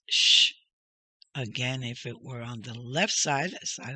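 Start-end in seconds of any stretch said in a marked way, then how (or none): 0.1-0.5 a lone, drawn-out "sh" consonant; SH sound. Shown as a demonstration. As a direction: isolate sh without any vowel. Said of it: "you pronounce it as".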